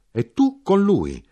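slow, very high, loud declaration; heated shout. A narrator's reading. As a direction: drive it home high and loud.

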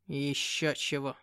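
low, slow scornful cat; sneering demon cat. Acted. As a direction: scoff like a proud demon. (презрительный кот-бес)

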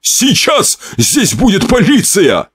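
very high, slow, frantic bawl; husband yelling at the killer. Acted. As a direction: bawl the threat in fear. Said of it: "вопил Павлов".

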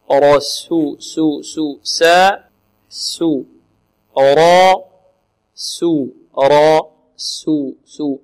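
drilled syllables, full را against thin سو; heavy R, light S. Shown as a demonstration. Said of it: "الراء مفخمة والسين مرققة".

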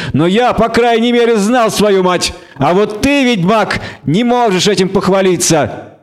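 high-pitched gloating retort; taunting half-elf, pleased with the jab; smirking. A narrator's reading. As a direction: throw the insult back through a grin. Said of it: "не переставая усмехаться".

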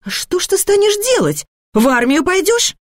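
high-pitched irritated questioning; mother at the end of her patience; exasperated challenge. Acted. Mother arguing, barely holding back.

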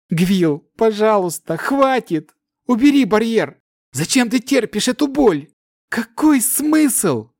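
high pleading cries; desperate shouting. A narrator's reading. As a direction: shout to get through to her. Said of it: "Пытался докричаться Кирос".

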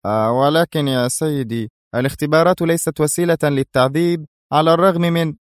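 perplexed objection, rising in pitch; baffled, hesitant subordinate; confused. A narrator's reading. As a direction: object in bewilderment to a superior. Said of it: "غمغم هوندو في حيرة".

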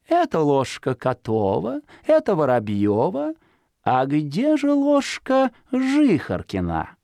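high, slow, teasing fox voice; sly fox. A narrator's reading. Fox baiting hidden Zhikharka, teasing high fox voice. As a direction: tempt him out like a cunning fox.

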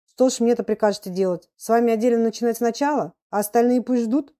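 lightly hammed-up exasperated schoolmaster; irritated teacher. A narrator's reading. Teacher rebukes a pupil who was ill, irritated.

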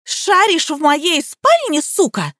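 slow, high outraged accusation; furious. A narrator's reading. Liza catches Nika snooping in her bedroom, furious.

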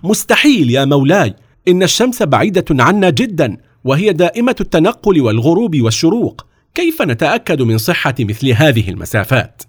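high, heated objection; agitated protest. A narrator's reading. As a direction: burst out in indignant disbelief. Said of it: "الوزير تقدم ثائرا".

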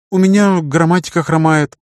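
put-on girl sheepishly admitting a weakness; embarrassed girl. A narrator's reading. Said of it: "Ната покраснела". (смущённая девушка, высокий голос)

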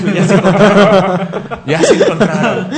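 laughing hard and loud, slowly. Real, not acted. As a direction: laugh the words out loud as you repeat them. Laughing at the couple's high five. (riéndose a carcajadas)

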